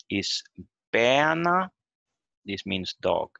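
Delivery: usual speaking voice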